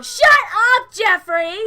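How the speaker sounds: high, loud shout to silence him; angry shout. Acted. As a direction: cut Jeffrey off with a yell. Character snaps at Jeffrey.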